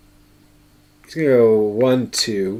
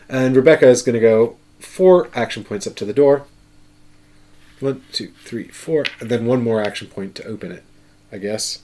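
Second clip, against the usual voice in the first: ordinary talk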